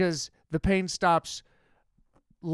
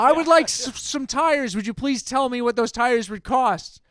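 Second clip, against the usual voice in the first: higher, louder, sugary-polite customer; overly polite request; 6 semitones higher, 4 dB louder than usual. Retold or imitated. Customer asking the dazed clerk about tires, over-politely. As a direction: exaggerate the politeness, sweet and careful. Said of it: "No, I said".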